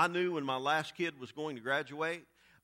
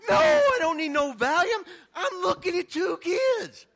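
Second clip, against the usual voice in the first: much higher, loud frantic cry of disbelief; panicked shout; 12 dB louder, slower than usual. Retold or imitated. To the nurse offering Valium, a high loud stunned shout. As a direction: shout it like the twins just knocked you flat. Shocked at seeing twins.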